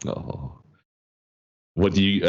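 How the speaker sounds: usual speaking voice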